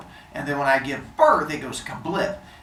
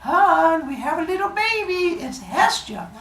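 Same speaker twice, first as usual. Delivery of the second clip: put-on high-pitched delighted mom announcing a baby; high, cooing baby announcement; excited new mother; 13 semitones higher than usual. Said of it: "she's like".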